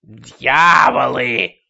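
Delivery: slow shrieking cry of terror; piercing scream. Acted. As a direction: shriek it out like a madwoman. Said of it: "пронзительный крик".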